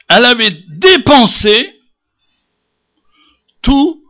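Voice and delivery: loud, high scripture declamation; forceful preaching. Acted. Preacher proclaiming what the woman spent, forceful. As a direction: punch out the words with pauses between.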